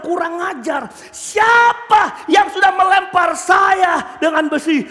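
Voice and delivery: slow, emphatic shouted demand; angry outburst. Acted. Engineer struck by iron demands the culprit, furious. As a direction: roar out who dared do this. Hit engineer comes round and rages.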